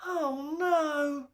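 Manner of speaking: high, small creature moan; a tiny tooth fairy groans in dismay; moaning Milktooth. A narrator's reading. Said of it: "moaned Milktooth".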